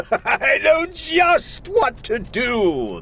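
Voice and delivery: high, slow, scheming; gleeful Grinch. Acted. Grinch delighting in his awful idea, slyly. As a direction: savor the plan, sly and gleeful. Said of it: "the Grinch laughed in his throat".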